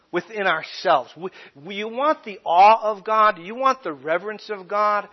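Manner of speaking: ordinary delivery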